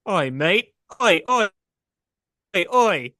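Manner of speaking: a light mock Australian "oi, mate"; lightly voiced Aussie bloke shouting oi; playful put-on Australian accent. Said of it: "He's Australian".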